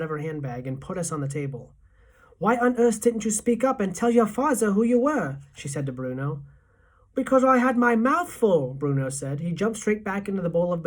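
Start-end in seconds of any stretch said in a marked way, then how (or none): 2.4-5.4 higher-pitched grandmother with th as z; lightly accented, cross old woman; scolding grandmother. A narrator's reading. 7.1-8.7 put-on loud, higher boy's voice; indignant child, louder and higher; greedy boy. A narrator's reading. Greedy boy answers back.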